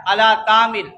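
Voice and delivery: "علی طعام" as ala taam, both ains dropped; mispronounced recitation. Retold or imitated. Teacher shows how ain gets lost, "طعام" mispronounced. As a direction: imitate careless "طعام" with light t. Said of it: "عین بھی گیا".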